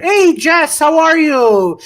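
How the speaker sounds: high, loud, drawn-out hello; big singsong welcome; delighted greeting. Real, not acted. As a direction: call out happily to a friend just arriving. Greeting a viewer who joins.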